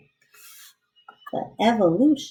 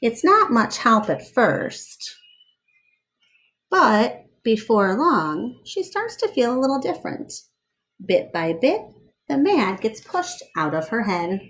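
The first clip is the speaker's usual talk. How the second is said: lively read-aloud storyteller voice; story narration; 4 dB louder, slower than usual. Acted. Reading a picture book aloud to children, lively storyteller voice.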